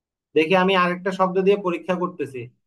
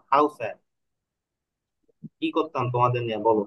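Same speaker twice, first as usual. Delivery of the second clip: exasperated; 7 semitones lower than usual. Real, not acted. Teacher exasperated with class.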